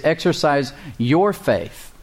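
YOUR set apart and leaned on in "Your Faith"; stress on YOUR. Shown as a demonstration. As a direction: punch the word Your, then let Faith follow. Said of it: "with the emphasis on the word your".

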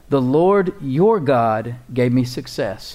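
slow reading with "your" leaned on hard; stress on YOUR. A narrator's reading. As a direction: draw out and underline the word "your". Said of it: "underline that word "your".

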